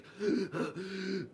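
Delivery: a sharp, high gasp for air; imitated gasp. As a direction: gulp in air like a choking child. Girl gasping for air.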